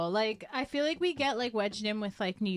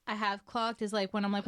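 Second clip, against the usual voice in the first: ordinary delivery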